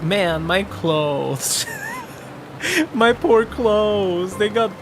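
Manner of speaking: slow, mournful big man lament; drawn-out mock grief over clothes; big guy voice. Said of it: "is Seven like".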